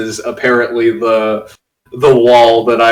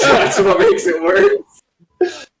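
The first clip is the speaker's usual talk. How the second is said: laughing through the words; cracking up, slow and high; much slower than usual. Real, not acted. Embarrassed laughing at own accent.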